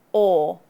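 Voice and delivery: loud rounded "or" with chin pulled back; rounded demo. Shown as a demonstration. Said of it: "make a round shape with your lips".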